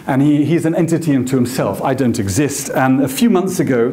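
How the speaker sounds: ordinary talk, nothing marked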